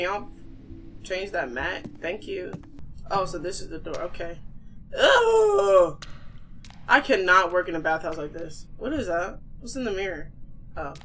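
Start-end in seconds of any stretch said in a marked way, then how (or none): 5.0-6.0 loud, high groan of disgust. Real, not acted. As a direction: groan like you've touched something gross. Disgusted by the dirty bathhouse.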